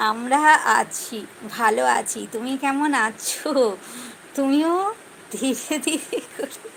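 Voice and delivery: slow, loud, every word spaced out; slow and deliberate. Shown as a demonstration. Answering live viewers, slow and drawn out. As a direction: spell it out slowly so they can follow. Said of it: "ধীরে ধীরে করে বলো".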